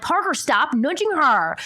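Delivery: acted parental reprimand, high and slow; scolding parent. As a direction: scold a child across the room.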